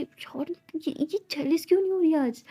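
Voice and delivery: high frustrated grumble. Acted. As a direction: whine it, puzzled the plan fails. Frustrated plan failing.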